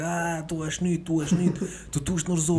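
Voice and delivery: mock accusing crowd chant; jeering crowd. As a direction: imitate onlookers taunting the sick man. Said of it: "die sich um dich herum versammeln und abschauen und sagen".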